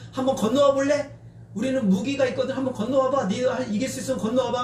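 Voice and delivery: high, sing-out provocation; taunting dare. Acted. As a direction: dare them to come over, mockingly. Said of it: "조롱하고 유혹하는 거예요".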